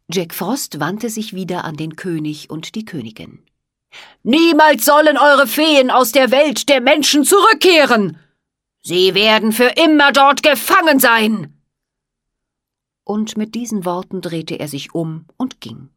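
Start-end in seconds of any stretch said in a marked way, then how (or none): 4.2-11.5 loud, higher cold villain threat; menacing threat. Acted. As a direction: declare it with cruel triumph.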